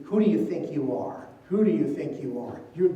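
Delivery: ordinary delivery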